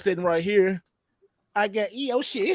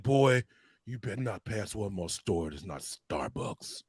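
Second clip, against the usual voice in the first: much lower, much softer, breathy whispered warrior voice; Kratos whisper; 10 semitones lower, 9 dB quieter, slower than usual. Retold or imitated. Demanding coffee from his friend, in a low whispered warrior voice. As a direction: hush it down low like a menacing warrior. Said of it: "I did the Kratos".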